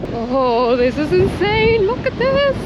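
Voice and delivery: rising, high-pitched Irish cliffs awe, stretched out slowly; real excitement. Real, not acted. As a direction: gasp it out, amazed by the view. Awed by the cliff view.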